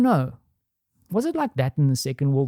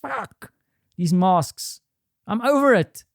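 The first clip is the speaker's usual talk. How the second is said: exasperated outburst; 6 semitones higher, slower than usual. Retold or imitated. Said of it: "she was just, like, going".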